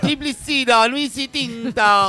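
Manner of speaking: high, drawn-out promo chant; shouting announcer. Acted. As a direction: blare out the contact details like an announcer. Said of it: "¿Pero por qué gritas ahí al lado?".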